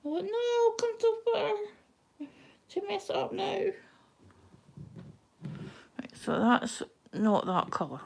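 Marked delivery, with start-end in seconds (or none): 0.1-3.6 high, anxious squeak; nervous squeak. Real, not acted. Stitcher nearly done, fearing a mistake, squeaks high and nervous. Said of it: "I'm shaking".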